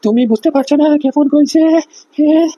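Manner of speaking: put-on a scared old officer forcing the name out; frightened, struggling voice. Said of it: "গলার কণ্ঠস্বর শুনে মনে হচ্ছে স্যার খুব ভয় পেয়ে গেছে". (ভয়ে কাঁপা, কষ্ট করে বলা গলা)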